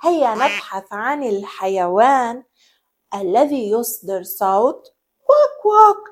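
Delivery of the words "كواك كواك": high, loud single quack; one sharp duck quack. Shown as a demonstration. Teacher quacking for the children, high.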